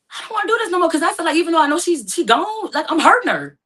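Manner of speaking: high, loud, rushed plea; pleading in rising panic; distressed. Retold or imitated. Begging the 911 operator to stop CPR, high and loud.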